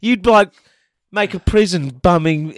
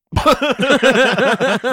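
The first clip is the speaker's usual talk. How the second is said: laugh; 4 semitones higher than usual. Real, not acted. Laughs at "dollops.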